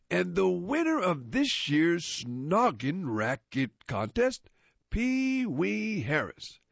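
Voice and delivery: slow, grand monster announcer. Acted. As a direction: announce the winner slowly and grandly.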